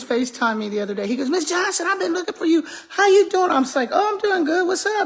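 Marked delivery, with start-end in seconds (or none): 1.3-3.5 high-pitched imitation of an eager student; eager greeting. Student FaceTimes teacher looking for her, imitated eager greeting. 3.9-5.1 high-pitched, re-enacted friendly hello; cheery greeting. Teacher answers her student's FaceTime, re-enacted cheery greeting.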